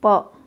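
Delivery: प punched loud; forceful प. Shown as a demonstration. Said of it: "प का उच्चारण जोर से करेंगे". (प पर ज़ोर)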